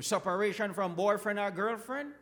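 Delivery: ordinary talk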